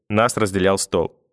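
ordinary delivery, nothing marked

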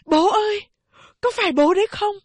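gọi cao vút, mừng rỡ; gọi to người cha vừa về; kêu to. A narrator's reading. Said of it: "kêu to". (con trai kêu to gọi bố)

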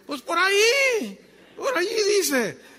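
high, loud, slow flustered bluff, acted out. Novato no halla el versículo.

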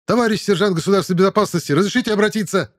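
fast, loud, formal military address; snappy recruit. A narrator's reading. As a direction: rattle it off like a keen soldier.

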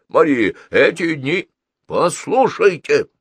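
impassioned high-pitched soothsaying; excitable consul, rapt palm reader. Acted. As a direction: intone the prophecy with rising fervour.

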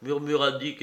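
plain reading voice

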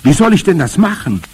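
high, loud, baffled question; incredulous cry. A narrator's reading. Boy questions grandmother's huge task, incredulous cry. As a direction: protest that it cannot be done. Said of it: "rief ich".

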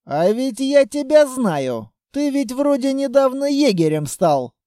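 high, excited realisation; sudden recognition. A narrator's reading. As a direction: cut in as it dawns on you. Sudden recognition of the narrator's character.

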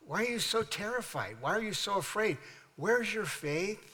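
Jesus questioning. Acted. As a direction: question them softly, slowing at the end. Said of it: "Then he says".

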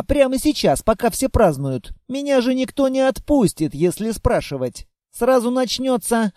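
usual speaking voice

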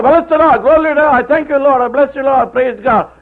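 preacher mocking shouting worshippers with hollow praise; mock church shouting. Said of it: "everybody is shouting, on top of their voice".

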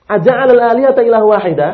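a half-hearted yell of protest; mock shouting. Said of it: "teriak-teriak".

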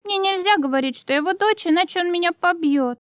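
put-on hushed, high scared girl; frightened child. A narrator's reading. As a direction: whisper it like a frightened little girl. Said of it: "испуганно".

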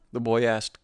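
plain reading voice

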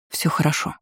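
softly murmured reassurance; whisper. A narrator's reading. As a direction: say it under your breath, softly. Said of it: "прошептала". (тихо, почти шёпотом)

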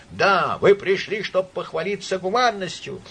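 high, relentless shouted tirade; raging drunken denunciation; unrestrained fury. A narrator's reading. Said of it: "продолжал кричать с неудержимой яростью".

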